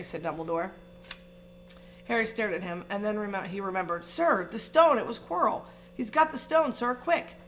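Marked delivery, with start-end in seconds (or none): 4.0-7.4 high, urgent, breathless alarm. Acted. Harry urges Dumbledore about the stone, high and alarmed. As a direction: blurt it out in a panic.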